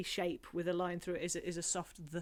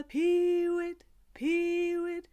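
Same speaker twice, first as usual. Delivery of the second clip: lapwing cry; 9 semitones higher, 4 dB louder, much slower than usual. Acted. Bird call in the song.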